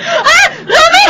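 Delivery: high rising panicked shout; sudden alarm. Acted. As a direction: cry out as the car swerves. Sees danger on road.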